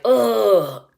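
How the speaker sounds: exasperated groan. Retold or imitated. Frustrated at people who won't hear it, an exasperated groan.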